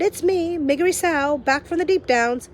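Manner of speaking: put-on a simple girl bellowing her arrival; Meg shouting. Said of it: "she shouted".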